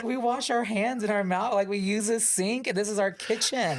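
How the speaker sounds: high, outraged disbelief; appalled. Retold or imitated. Coworkers scold the girl who peed in the sink, high and appalled. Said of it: "We're like".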